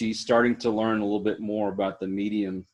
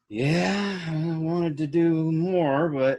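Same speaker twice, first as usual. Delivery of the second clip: drawn-out, higher, reluctant letdown; awkward brush-off; 6 semitones higher, slower than usual. Retold or imitated. Date lets the medium down gently, awkward and drawn out. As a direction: imitate a half-hearted date excuse.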